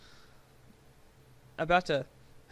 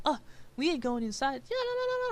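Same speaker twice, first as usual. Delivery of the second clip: higher, whiny mock of the girlfriend; 11 semitones higher, faster than usual. Imitating his girlfriend refusing to go inside, higher and whiny. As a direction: whine it like she's complaining.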